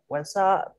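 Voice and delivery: "WhatsApp" as wa-sap, pitched high; linked-sound demo. Shown as a demonstration. Teacher coaching the linked WhatsApp, high demo. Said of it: "Anh đi sang một chút nhé".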